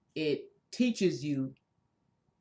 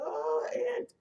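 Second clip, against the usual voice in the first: high excited cry. Retold or imitated. Acted exclamation in a story.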